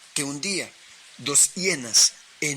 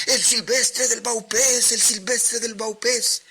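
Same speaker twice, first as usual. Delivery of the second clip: high, loud echoed shout from the crew; sailors shouting back; 9 semitones higher, 3 dB louder than usual. A narrator's reading. Sailors shouting the captain's order back, loud high shout. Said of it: "Y todos los marineros gritaban".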